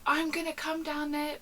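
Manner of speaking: mock threat, pitched high; hammed-up high-voiced threat; threatening caller. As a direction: imitate an irate caller kicking off.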